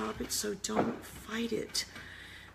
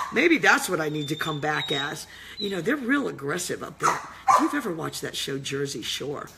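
loud mock rant; 4 semitones lower, 7 dB louder than usual. Real, not acted. Mocking aggressive Jersey women.

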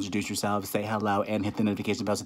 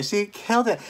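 higher and louder, gushing; excited; 8 semitones higher, 6 dB louder than usual. Real, not acted. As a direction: gush with real excitement. Gushing over the video.

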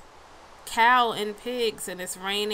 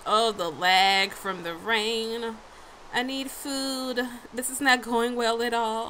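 ordinary delivery

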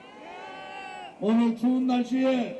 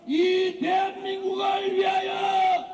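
high, slow shouted battle cry; rally the crowd to fight; roaring rallying speech; 8 semitones higher, slower than usual. Real, not acted. (외치는 투쟁 구호)

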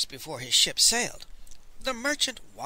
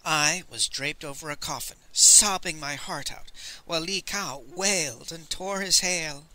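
usual speaking voice

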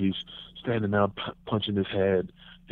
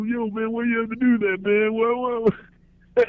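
much higher, drawn-out whine; whiny protest; 13 semitones higher, 3 dB louder, slower than usual. Retold or imitated. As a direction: plead like a hurt kid.

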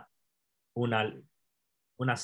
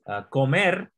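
ordinary delivery

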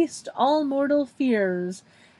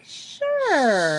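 drawn-out, put-on hesitant agreement; tentative; 2 semitones higher than usual. Phoebe agreeing to cover for her boss, acted tentative. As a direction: act out an unsure yes. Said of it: "tentatively is like".

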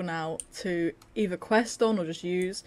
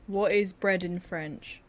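slow clear for Siri; slower than usual. Real, not acted. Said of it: "Hey Siri".

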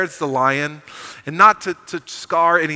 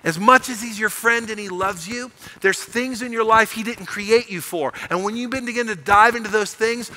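usual speaking voice

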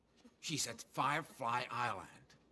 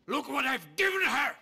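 higher, louder outraged yell; angry shout; 9 semitones higher, 9 dB louder than usual. Acted. Father rages over his ungrateful daughter, shouting. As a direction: throw the words out in fury.

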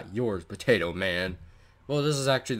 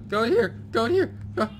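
frantic; 9 semitones higher, 4 dB louder, faster than usual. Real, not acted. Frantic hiding.

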